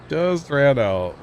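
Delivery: joking slow-motion repeat; drawn out. Pretend. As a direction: playfully stretch out each word. Echoes own words after laughing.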